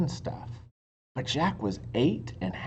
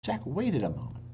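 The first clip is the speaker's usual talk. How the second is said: ordinary delivery, nothing marked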